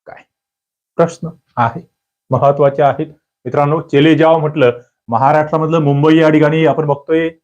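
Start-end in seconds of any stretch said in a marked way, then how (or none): none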